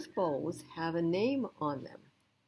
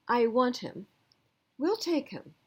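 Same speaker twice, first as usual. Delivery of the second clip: put-on higher and louder, insistent child; eager little boy; 6 semitones higher, 5 dB louder than usual. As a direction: demand it like a kid set on his dog. Little boy claiming the dog.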